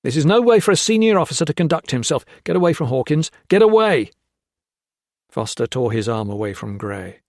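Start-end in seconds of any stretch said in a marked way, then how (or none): 0.0-4.1 high, loud, fast shouting. Acted. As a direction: shout him down. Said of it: "Gray shouted".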